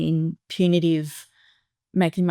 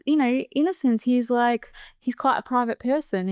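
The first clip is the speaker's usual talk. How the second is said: ordinary talk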